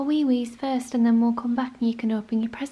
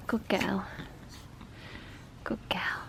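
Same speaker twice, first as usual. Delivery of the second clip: soft, hushed praise; whisper; 7 semitones lower, 10 dB quieter than usual. Real, not acted. Praising Lily softly.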